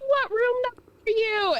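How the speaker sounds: high imitation of someone calling up. Searchers calling to lost child aboard ship, high-pitched. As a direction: act out calling to a lost kid.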